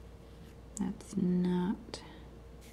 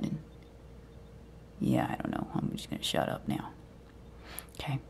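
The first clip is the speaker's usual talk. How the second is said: ordinary delivery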